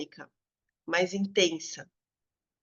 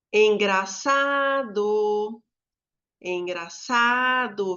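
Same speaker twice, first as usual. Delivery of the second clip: ENGRAÇADO called out slowly, ÇA held long, then DO; sing-song call of "Engraçado", stretched ÇA; musical calling; much slower than usual. Shown as a demonstration. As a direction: call "Engraçado" to come over, drawing out ÇA. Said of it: "como se eu estivesse chamando, é, musicado".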